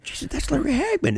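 high, hushed recognition; stunned little aside on spotting a star; retold amazement. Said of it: "so I, I'm like".

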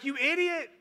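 high-pitched comic outrage; hammed-up indignant outburst; mock exasperation. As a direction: imitate a man fed up with his foolish rescuer. Trapped man berates his rescuer.

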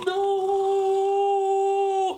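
very high, drawn-out "nooo"; long mock wail. Host dreading agile development question, high mock wail. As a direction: wail a sustained high-pitched cry of protest.

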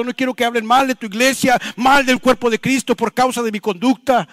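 fervent plea building in force; impassioned preached prayer. Real, not acted. Pastor showing the congregation what to ask God, impassioned preaching. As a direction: plead with God, voice rising as it goes.

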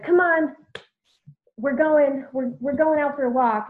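rally a gloomy friend out the door; cheery rallying call. Retold or imitated. Heather drags her moping friend out for a walk, cheerfully loud.

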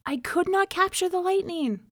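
a hint of a wronged man protesting; bitter protest to the spirit; complaining cry. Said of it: "he cried to the water".

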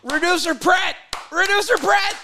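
high excited shout; excited guess. Real, not acted. Guessing the new hire.

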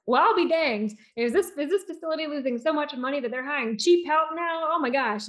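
lightly acted old man's exasperated disbelief; retold indignant patient. Patient complains facility is hiring cheap help, mock-indignant.

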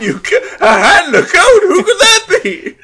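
laughing through the words; high, loud laughing disbelief. Real, not acted. Laughing at the obvious clue.

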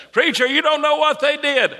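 very high protesting voice; protesting churchgoer. Acted. Churchgoer objecting to preacher about forgiving, protesting.

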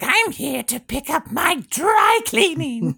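high, slow cartoon villain voice. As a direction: say it like a pompous villain. Cobra Commander doing errands.

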